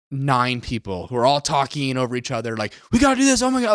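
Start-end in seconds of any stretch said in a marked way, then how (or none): 2.9-3.7 high, hurried mock panic. Teammates all shouting at once, in hammed-up panic. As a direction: squeak it out like a panicked crewmate. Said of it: "like, panicking".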